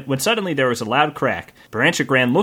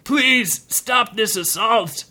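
pleading protector; 6 semitones higher, much slower than usual. Acted. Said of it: "said the protector".

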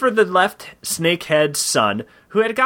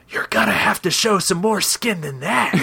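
higher put-on voice of the wind; playfully voiced wind, higher than the narrator; 4 semitones higher than usual. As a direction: speak as the teasing wind, pitched up. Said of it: "in it you could hear".

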